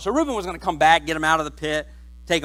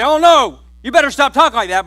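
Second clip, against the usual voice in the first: firm defence; 5 semitones higher, 9 dB louder than usual. Acted. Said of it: "they're not gonna say".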